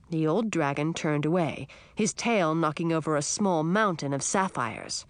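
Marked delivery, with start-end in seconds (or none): none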